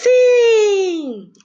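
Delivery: high, loud, bright shout of yes; pupil's cheerful reply; eager answer. Shown as a demonstration. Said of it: "quando vem a resposta".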